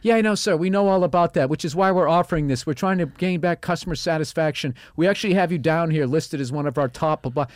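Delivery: high, eager sales patter; smooth-talk the customer into a deal; fake soothing salesman. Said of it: "just lying your fucking ass off".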